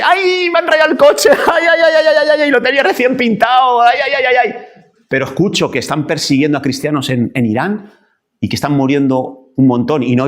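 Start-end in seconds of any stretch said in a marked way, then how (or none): 0.0-4.6 very high, hammed-up crybaby wail; exaggerated "ay, ay, ay" lament; mock wailing. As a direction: bawl theatrically over a trivial loss. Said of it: "Si lloramos porque nos han rayado el coche".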